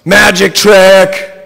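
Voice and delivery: loud, high, drawn-out shout. A narrator's reading. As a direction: shout it out high, stretching each word. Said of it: "Brittany yanks something out of her pants and shouts".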